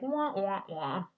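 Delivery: sad-trombone "wah wah wah"; mock bummer sound. Wrapping up the episode on a bummer, mock sad trombone. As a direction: imitate a comic letdown horn. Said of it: "that was kind of a bummer".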